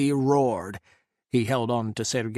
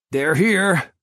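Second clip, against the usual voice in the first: a loud, higher shout; shouted warning; 5 semitones higher, 6 dB louder than usual. A narrator's reading. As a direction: call the alarm across the ice. Warning as the first bullet flies.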